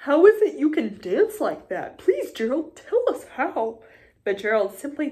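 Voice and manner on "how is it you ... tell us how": eager pleading. Acted. Animals pleading with Gerald.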